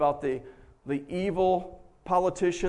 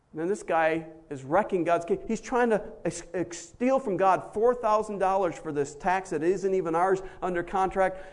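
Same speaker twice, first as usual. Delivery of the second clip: usual speaking voice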